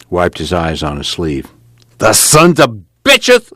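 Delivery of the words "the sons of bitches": high, loud, drawn-out curse; bitter outburst, slow and forceful; angry curse. Acted. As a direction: spit it out after a humiliation. Tom curses after being humiliated.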